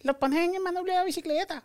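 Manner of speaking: put-on high old grandma voice; squeaky mock abuela giving orders; imitating grandma. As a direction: grandmother brushing off a kid's complaint, high voice. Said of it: "Y te decía".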